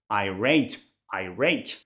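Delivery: irate said slowly, low, eye-RATE; pronunciation demo. Shown as a demonstration. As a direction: model irate carefully for learners. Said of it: "Listen to the pronunciation".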